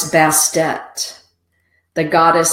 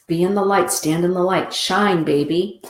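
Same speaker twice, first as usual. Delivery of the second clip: normal talking voice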